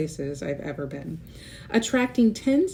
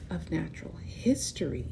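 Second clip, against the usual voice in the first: whisper; 3 semitones lower, 6 dB quieter than usual. Acted. Mystery inside the museum.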